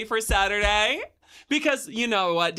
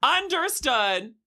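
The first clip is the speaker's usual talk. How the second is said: ordinary delivery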